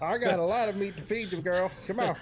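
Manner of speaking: southern Anatoly voice. Pretend. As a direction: tease in a put-on southern twang. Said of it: "likes this kind of accent".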